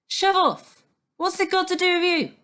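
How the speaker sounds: loud high tearful yell; angry shout. A narrator's reading. Natasha yelling at Stan through the screen, loud and tearful. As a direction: throw him out with your voice. Upset girl snaps at boy.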